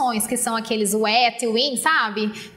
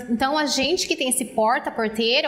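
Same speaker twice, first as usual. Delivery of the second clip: usual speaking voice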